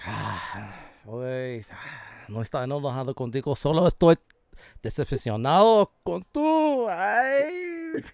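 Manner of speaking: exasperated Spanglish mom. Acted. Mom telling her son she is disappointed, exasperated. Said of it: "like, a breath when you know you're gonna say a lot".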